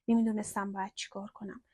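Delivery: ordinary delivery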